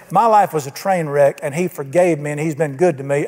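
ordinary talk

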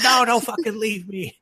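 high-pitched mock-panicked plea; panicked cat. Stuck cat begs the man not to go, high comic panic. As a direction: wail it up high like a cartoon cat. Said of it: "And he's like".

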